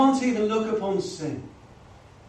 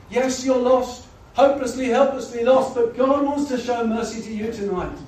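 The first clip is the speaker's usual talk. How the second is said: loud, pleading pulpit call; impassioned appeal; 4 semitones higher, 6 dB louder than usual. Acted. Preacher calls the lost to mercy, loud and pleading.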